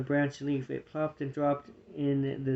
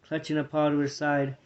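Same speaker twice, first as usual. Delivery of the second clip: usual speaking voice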